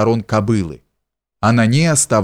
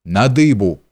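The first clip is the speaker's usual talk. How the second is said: shout. A narrator's reading. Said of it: "Он крикнул".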